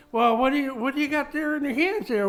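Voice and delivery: acted high-pitched questioning; talking pony. Pony questions him in story.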